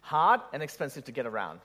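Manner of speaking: usual speaking voice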